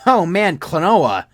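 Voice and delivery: put-on a friend lighting up at an old favorite; excited friend. Friend replying to my email, excited and loud. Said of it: "he replied".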